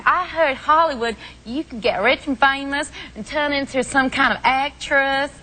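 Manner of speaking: put-on a breezy ditzy American starlet accent. British guest doing an American accent on request, high and hammed-up. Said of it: "Do your American accent".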